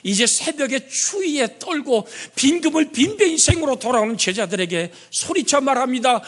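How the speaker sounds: high, storytelling sermon voice; preaching. Acted. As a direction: paint the seashore scene for the flock. Preacher narrating the gospel scene.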